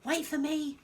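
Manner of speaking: high, loud call after the cat; a boy calling out to be waited for. Acted. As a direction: call after them through the jungle. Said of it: "Tom called".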